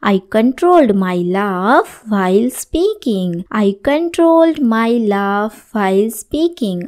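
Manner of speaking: slow, clear classroom reading; slow model sentence. Shown as a demonstration. Teacher reading the example to students, slowly. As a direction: spell the sentence out for learners. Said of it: "teacher models English sentence".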